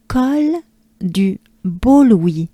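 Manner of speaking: slow French "Col du Beau Louis" with open vowels; slow, open-vowelled French. Shown as a demonstration. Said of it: "lots of vowels here and open".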